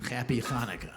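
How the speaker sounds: "Hanukkah" with throaty ch sound; guttural ch Hanukkah. Shown as a demonstration. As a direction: say "Hanukkah" with a rasped ch at the start. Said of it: "I like to use the ch".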